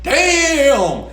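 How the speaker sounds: high, drawn-out mock amazement; impressed reaction. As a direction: stretch it out high, as if stunned. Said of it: "A lot of you are like".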